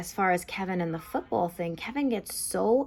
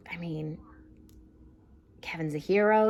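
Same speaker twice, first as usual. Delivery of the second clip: whispered aside. Real, not acted. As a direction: whisper it like a confession. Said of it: "whispered emphatic aside".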